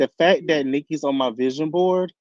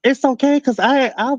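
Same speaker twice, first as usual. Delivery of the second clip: defensive squeal; 10 semitones higher, 5 dB louder than usual. Real, not acted. Defensive reply to friend.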